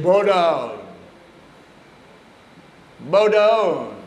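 loud imitated Bengali-accented command. Guru commanding his new initiates, loud Bengali accent. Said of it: "his Bengali accent".

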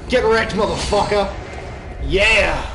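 loud triumphant shout; battle yell. Real, not acted. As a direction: roar out the win. Killing the enemy.

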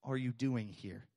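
soft, low gentle question; God's quiet, searching voice; still small voice. Acted. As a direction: ask it barely above a breath. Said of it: "in a still small voice".

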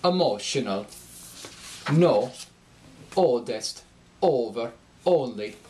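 "Emotional", "No", "Oldest", "Over", "Only" with a pure, unglided Scandinavian O. Shown as a demonstration. Coach demonstrating the Scandinavian long O. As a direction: hold a clean Norwegian O with no glide. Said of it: "will become O".

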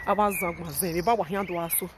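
ordinary delivery

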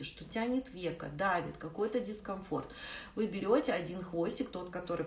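usual speaking voice

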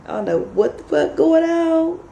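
high-pitched voice for the dog; Bailey. Retold or imitated. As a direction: voice a baffled pet, well above your own pitch. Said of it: "Bailey's just in the house like".

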